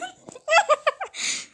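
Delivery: very high, rapid cackle; high-pitched giggle. Acted. Another burst of dragon laughter.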